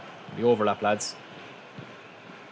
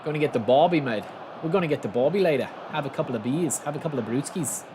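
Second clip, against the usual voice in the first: sing-song mock Aussie; Australian accent. Shown as a demonstration. Streamer trying out slang for the chat, Australian accent. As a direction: ham up an Australian planning a barbie. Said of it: "Is that Australian as well?".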